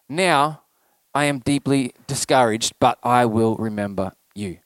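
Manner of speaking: low, subdued reading of a discouraged psalm; deeply discouraged. Acted. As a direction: read it low and downcast, with pauses.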